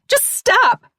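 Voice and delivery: high, loud panicked cry; terrified loud cry; cried out. A narrator's reading. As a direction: scream at him to stop. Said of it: "Mare cried".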